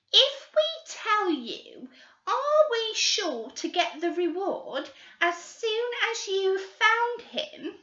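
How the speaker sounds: put-on high, slow, wheedling girl voice; greedy schoolgirl. Girl bargaining with the detectives, high and wheedling. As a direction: bargain sweetly for the reward.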